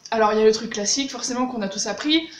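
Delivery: usual speaking voice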